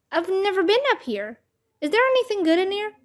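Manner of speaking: high-pitched, eager questioning; curious wonder. A narrator's reading. Babysitter peering into the crawl space, curious wonder. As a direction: look round with lifted, interested voice.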